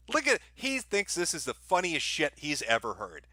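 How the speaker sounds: ordinary talk, nothing marked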